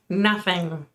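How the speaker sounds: ordinary delivery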